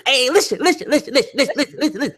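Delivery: high-pitched, nagging stream of listens; mimicked insistent interrupter. Podcaster imitating a debater who talks over everyone, insistent interrupting. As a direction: keep butting in with the same high plea. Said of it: "All you can hear is".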